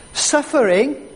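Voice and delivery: ordinary talk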